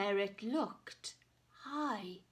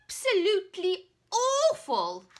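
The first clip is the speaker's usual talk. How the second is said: a hint of a grumbling dragon; puffing; 7 semitones higher, 9 dB louder than usual. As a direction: huff it out, louder and higher. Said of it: "he puffed".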